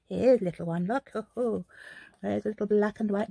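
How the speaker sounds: lightly croaked witch voice; a hint of an old witch; witchy voice. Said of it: "That sounds like a witchy voice".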